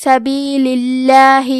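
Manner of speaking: اللہ in سبیل اللہ with a light, thin lam; slow, keep the lam of اللہ thin. Shown as a demonstration. Teacher shows students a thin lam, slowly. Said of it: "حرف اللام کو پتلی آواز میں پڑھنا ہے".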